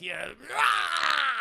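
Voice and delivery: high, loud drawn-out yell; mock villain scream. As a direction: imitate an attacking villain's cry. Mimics Palpatine's lightning attack.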